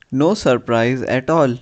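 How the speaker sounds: ordinary talk, nothing marked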